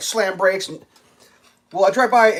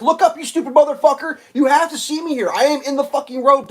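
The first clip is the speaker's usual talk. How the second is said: angry yell; 9 semitones higher than usual. Retold or imitated. Driver yelling at a distracted driver. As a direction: yell it, outraged.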